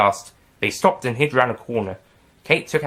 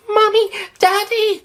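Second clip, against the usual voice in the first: put-on high, slow child's cry; a scared small boy calls his parents; little boy crying out; 5 dB louder, slower than usual. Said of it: "cried the boy".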